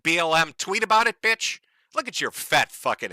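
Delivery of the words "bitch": usual speaking voice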